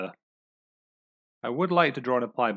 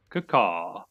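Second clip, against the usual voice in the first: ordinary delivery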